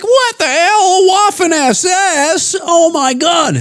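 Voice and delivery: high, slow stunned gasp of disbelief; shocked disbelief. Retold or imitated. Viewer reacting to the mask coming off, high shocked disbelief. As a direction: squeak it out in slow-dawning shock. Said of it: "and you're like".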